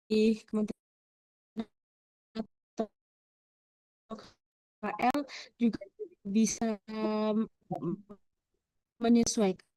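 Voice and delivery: choppy broken connection; words cutting in and out; glitching audio. Real, not acted. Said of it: "Suaranya putus-putus".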